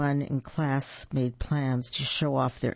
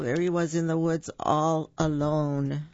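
plain reading voice

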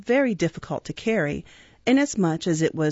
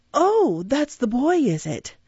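mild surprise; 5 semitones higher than usual. A narrator's reading. Undertaker peering at the new boy, mild surprise. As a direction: take notice with interest, starting high.

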